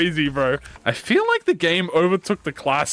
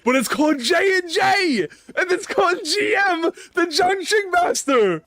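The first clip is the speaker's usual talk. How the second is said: excited shout; 11 semitones higher than usual. Real, not acted. Excited realization about name.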